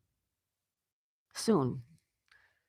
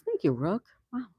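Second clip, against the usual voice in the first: ordinary talk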